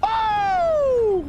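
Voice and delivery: high shout of triumph; excited shout. Real, not acted. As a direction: let it rip. Triumphant exclamation.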